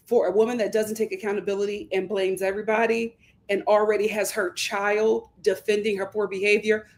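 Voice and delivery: normal talking voice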